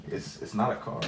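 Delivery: breathy whispered repeat, very soft. Real, not acted. Streamer to his chat, repeating it in a whisper. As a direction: whisper it as a muttered aside.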